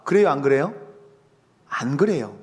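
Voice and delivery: ordinary talk, nothing marked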